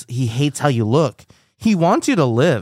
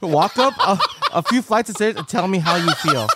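talking through laughter; laughing while talking; 4 semitones higher than usual. Real, not acted.

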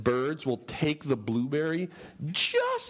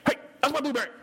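mock scolding; 11 semitones higher, much faster than usual. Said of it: "Be like".

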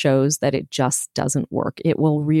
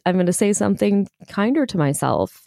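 ordinary delivery

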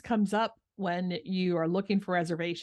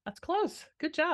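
teasing praise; 9 semitones higher than usual. Real, not acted.

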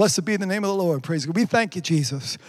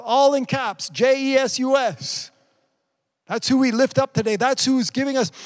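raised pitch, ringing proclamation; fervent preaching; 8 semitones higher, 4 dB louder than usual. Acted. Preacher lifting up Jesus before the congregation, fervent proclamation. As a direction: declare it high and full of conviction. Exhorts congregation, declares verse.

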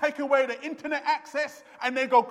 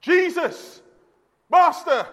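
raised, pleading cry to Jesus; desperate calling; 5 semitones higher, 4 dB louder, slower than usual. Retold or imitated. Preacher voicing lepers who stood at a distance, desperate cry. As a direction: call out high, as if from a distance. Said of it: "They were desperate".

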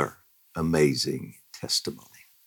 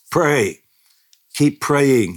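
higher, louder call to pray; urgent plea; 8 semitones higher, 4 dB louder, slower than usual. Retold or imitated. Husband urging family to pray, urgent. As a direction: press them to keep praying. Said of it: "he said".

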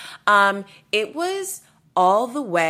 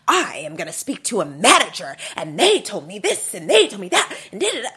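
mock a man ranting at staff; mocking yell; 4 dB louder than usual. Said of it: "he is, like, yelling".

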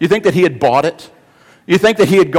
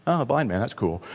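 bored indifferent reading; 9 dB quieter than usual. Pretend. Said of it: "read Scripture that way".

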